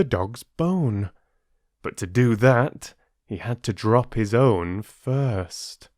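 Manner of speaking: gentle storyteller reading a fable; story narration. A narrator's reading. Narrator reading the dog fable to listeners, storytelling voice. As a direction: tell it like a bedtime fable. Reads Aesop fable aloud.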